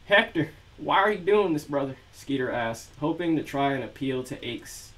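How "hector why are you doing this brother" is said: put-on southern accent. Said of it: "really bad southern accent for Scumbag Skeeter".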